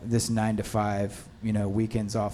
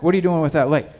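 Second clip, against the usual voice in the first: loud, raised-pitch challenge; retold scolding; 5 semitones higher, 8 dB louder, much faster than usual. Cinematographer to crew member setting a light, retold scolding. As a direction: imitate a boss pouncing on a crew member.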